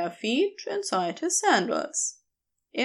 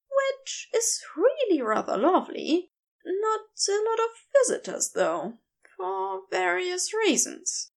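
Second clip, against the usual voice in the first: higher, gentle and eager to please; Aziraphale's voice; 8 semitones higher than usual. A narrator's reading.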